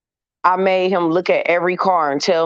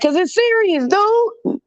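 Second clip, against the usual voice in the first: high-pitched exasperated snap at her son; mock-outraged mom, pitch flying up; 14 semitones higher, slower than usual. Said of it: "he brought out my anger".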